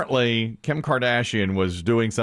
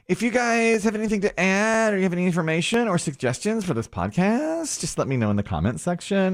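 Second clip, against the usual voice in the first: high, fast, breathy-free imitation of the host; mock podcaster voice; 6 semitones higher, faster than usual. Host reading her sign-off, mock high and fast. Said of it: "she'll say".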